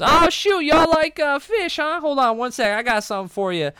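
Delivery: high, loud folksy barkeep. Acted. Barkeep eager to offer fish, high and loud. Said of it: "the barkeep goes".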